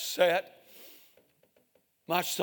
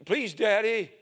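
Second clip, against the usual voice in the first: put-on higher child's voice, begging; pleading little boy; 5 semitones higher, 6 dB louder than usual. Little boy begs his father.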